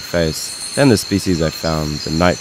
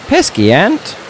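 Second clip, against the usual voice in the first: annoyed outburst; 14 semitones higher, 4 dB louder than usual. Real, not acted. Ant bites during filming.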